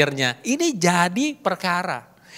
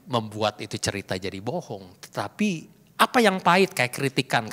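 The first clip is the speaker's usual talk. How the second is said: ordinary talk, nothing marked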